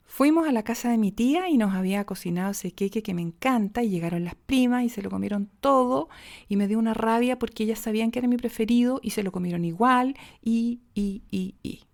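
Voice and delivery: one long breath chaining clauses with "y"; never land the sentence, keep adding "y"; trailing "y, y, y" without a full stop; run-on sentence. Shown as a demonstration. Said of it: "a poner un ejemplo".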